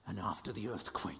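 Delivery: hushed whisper, dropping right down. A narrator's reading. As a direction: draw them in with a whisper. Hush before the gentle whisper.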